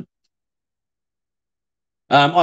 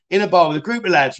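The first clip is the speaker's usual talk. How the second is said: ordinary talk, nothing marked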